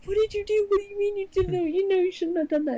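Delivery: frantic high-pitched disbelief at grandpa; freaking out. Retold or imitated. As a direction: voice jumps up high in alarm. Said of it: "and I'm freaking out".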